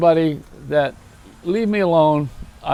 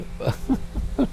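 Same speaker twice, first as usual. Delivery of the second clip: breathy laugh; 8 semitones higher, 8 dB quieter than usual. Real, not acted. Laughs at own joke.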